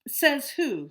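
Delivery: high, loud scoff; a bully's friend sneering at Amos; defiant challenge. Acted. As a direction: throw it back in his face.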